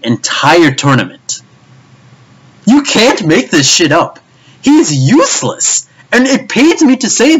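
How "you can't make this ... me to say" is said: exasperated. Real, not acted. Ranting, high and slow.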